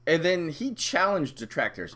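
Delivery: normal talking voice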